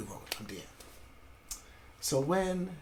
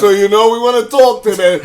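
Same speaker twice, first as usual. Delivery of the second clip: loud, higher Italian-American tough guy; mock Italian-American accent; 5 semitones higher, 10 dB louder than usual. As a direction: imitate a Brooklyn Italian heavy, loudly. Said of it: "These Italians".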